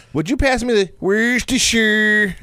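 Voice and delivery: high, drawn-out goofy request; silly voice. Shown as a demonstration. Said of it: "You do the silly voice".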